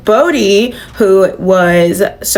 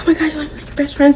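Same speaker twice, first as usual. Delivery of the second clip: higher, softer gushing imitation; hammed-up girly gush; mock gushing; 6 semitones higher, 4 dB quieter, faster than usual. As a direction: coo it back like a sweet best friend. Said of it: "And she was like".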